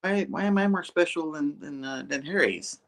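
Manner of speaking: mock-whine why his suit is different; whiny Pete. Retelling the show, Pete complains in a high whiny voice. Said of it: "And then Pete's like".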